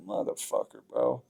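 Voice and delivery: breathless whispered curse, barely voiced; pained whisper. Just after his jaw snaps, he whispers a curse. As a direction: hiss the curse out under your breath, hurt.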